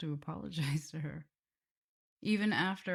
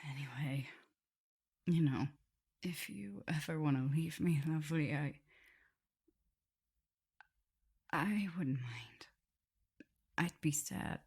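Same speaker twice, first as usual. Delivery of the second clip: sad whisper. Acted. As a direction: let them go gently. Offering captive freedom.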